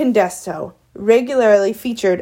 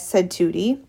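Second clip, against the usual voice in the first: ordinary delivery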